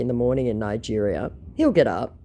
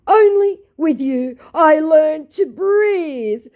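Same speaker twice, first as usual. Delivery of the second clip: high, loud, very slow mock romance; gushing scammer; 8 dB louder, much slower than usual. Scammer sends her copied love lines, slow hammed-up gushing. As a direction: draw it out like a hammed-up love poem. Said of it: "declared Google".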